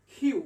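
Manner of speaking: "কিউ" with aspirated kh; correct letter Q. Shown as a demonstration. Teacher giving the real name of Q, aspirated pronunciation. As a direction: puff air after the k in "কিউ". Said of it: "কিউ এর উচ্চারণটা হবে".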